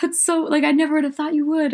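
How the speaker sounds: high, disbelieving gasp of surprise; shocked listener. Retold or imitated. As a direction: play a stunned fan hearing gossip. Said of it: "are listening are like".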